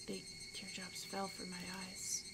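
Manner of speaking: barely voiced hushed whisper. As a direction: breathe the line out in shock. Shaken narration of sudden tears.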